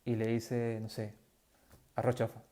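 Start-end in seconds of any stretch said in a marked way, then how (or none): 1.9-2.4 curt reply. Retold or imitated.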